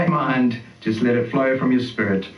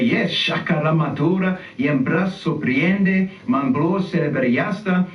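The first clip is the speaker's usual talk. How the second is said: slow, higher tongues chant; speaking in tongues; 3 semitones higher, slower than usual. Shown as a demonstration. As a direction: model the tongues boldly.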